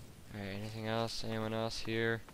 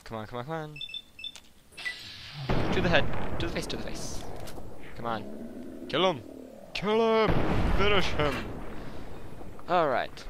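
shouting encouragement, much louder and higher; psyching myself up; 4 semitones higher, 7 dB louder than usual. Real, not acted. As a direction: hype yourself up mid-fight. Psyching up during a fight.

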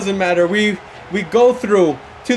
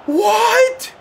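very high, loud, slow outcry; shocked disbelief; 6 dB louder, much slower than usual. Real, not acted. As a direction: cry out in disbelief. Stunned by another save.

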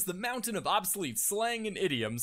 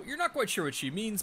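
ordinary talk, nothing marked

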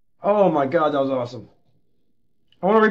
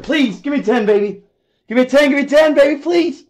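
eager pleading; 8 semitones higher than usual. Real, not acted. Pleading for a ten.

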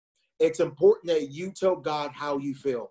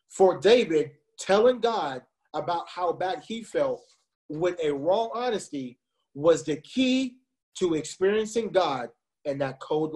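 usual speaking voice

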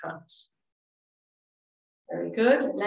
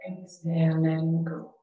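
calm, soothing relaxation voice with long pauses; guided meditation; 2 semitones lower than usual. Real, not acted. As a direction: guide them gently through each breath, leaving space. Leads breathing and meditation.

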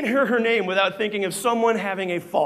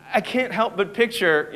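ordinary delivery

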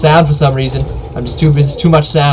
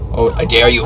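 usual speaking voice

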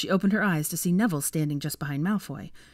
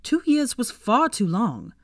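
stern; 7 semitones higher, 5 dB louder, slower than usual. A narrator's reading. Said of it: "he said sternly".

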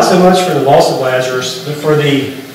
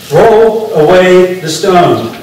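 very slow, weighted order; deliberate command; 2 semitones higher, much slower than usual. Acted. Jesus testing Martha's faith at the tomb, slow deliberate command. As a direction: give each word its own space, as a solemn test.

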